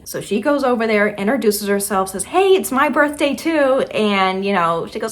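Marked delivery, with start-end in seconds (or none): 2.2-3.8 overeager stranger. Retold or imitated. Overeager stranger introduces herself.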